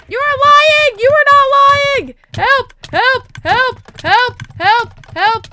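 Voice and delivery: high, loud frightened shouting; panicked yelling. Real, not acted. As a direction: shriek for help in short repeated bursts. Panicking in boss fight.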